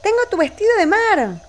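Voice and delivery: high excited call; high calling doll voice. Pretend. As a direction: call out to her friend.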